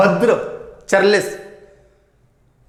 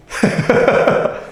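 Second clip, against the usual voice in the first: a short, breathy demo laugh; hearty laugh to illustrate giggle; 6 semitones higher than usual. Shown as a demonstration. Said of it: "ठाका लगा के हंसना".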